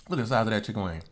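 usual speaking voice

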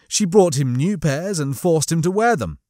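ordinary delivery

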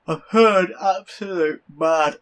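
words stretched through a yawn. Real, not acted. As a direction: talk mid-yawn, slow and drawn out. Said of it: "Yes, I just yawned".

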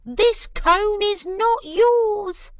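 high, loud, slow indignant squeak; angry squirrel. Acted. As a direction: stake your claim furiously. Squirrels squabble over the cone.

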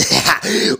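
evil cackle. Acted. Goblin villain laughing.

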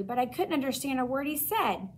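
ordinary delivery

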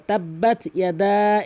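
slow chanted tajweed recitation of তাব্বাত ইয়াদা; Quran recitation. Shown as a demonstration. Teacher demonstrating the verse to students, slow recitation. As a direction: recite it slowly, drawing out the vowels. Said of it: "দেখেন অধিকাংশ লোক এখানে".

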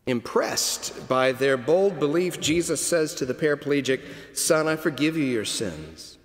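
ordinary talk

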